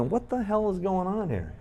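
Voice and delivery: high-pitched, incredulous question; baffled disbelief. Retold or imitated. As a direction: act out being totally baffled. Retells his bafflement waiting outside.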